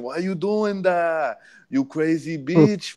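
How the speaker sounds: mock Spanish accent, high and slow. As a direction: imitate the Spanish dude's accent, drawn out. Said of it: "with an accent".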